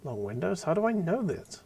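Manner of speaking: puzzled, pitch climbing high; acted bewilderment, swooping up; baffled questioning. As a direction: question it in rising disbelief. Reads the character's baffled question aloud.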